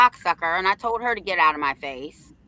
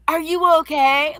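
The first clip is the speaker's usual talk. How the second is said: lightly voiced worried staff asking; a hint of a fussy adult's concern; principal's concern; 7 semitones higher, 5 dB louder than usual. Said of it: "it was like".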